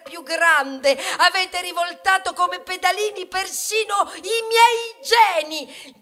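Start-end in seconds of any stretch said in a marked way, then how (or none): 1.2-6.0 loud, high furious tirade; angry shout. Acted. Wheat rages at humans over its genes, shouting. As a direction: hurl it at them, higher and louder.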